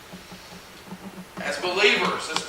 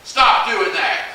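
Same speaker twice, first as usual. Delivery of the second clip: high, loud stern mom scolding; 6 semitones higher, 8 dB louder, much slower than usual. Acted. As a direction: scold like a mother catching a child. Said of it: "[name] is getting his mom voice out".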